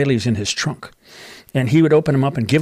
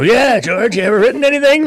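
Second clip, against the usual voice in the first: much higher, louder teasing copy of a famous singer; 10 semitones higher, 6 dB louder than usual. Retold or imitated. [name] mocking [name] by echoing the wife, much higher and louder. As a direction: echo her question in a put-on, raised mocking voice. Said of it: "Like [name], right?".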